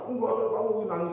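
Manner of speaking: insincere comfort. Acted. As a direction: wave the crying away without meaning it. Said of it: "Ada nangis nggak ikhlas".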